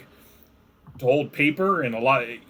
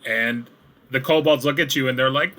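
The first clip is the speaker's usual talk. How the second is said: ordinary delivery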